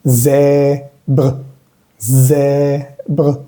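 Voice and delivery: "Zèbre" split as long "zè", pause, short "bre"; split syllables. Shown as a demonstration. Teacher breaks word down, "Zèbre" as zè ... bre. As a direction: break "Zèbre" into two drawn-out parts. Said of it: "la última E se dice".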